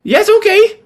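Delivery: put-on high-pitched eager kid voice; young boy.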